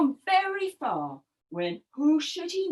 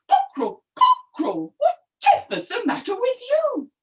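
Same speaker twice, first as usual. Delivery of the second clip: high, startled calling out; astonished, high-pitched questioning; surprised donkey; 9 semitones higher, 3 dB louder than usual. Acted. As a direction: call the name in alarm, then ask. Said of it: "Donkey was quite surprised".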